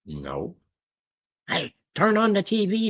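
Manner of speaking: ordinary talk, nothing marked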